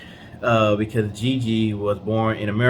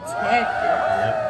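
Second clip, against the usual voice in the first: sudden high squeal of agreement; a bright, happy whoop of yes; excited yelp; 13 semitones higher than usual. Real, not acted. Husband cheering the new subscriber name, squealing.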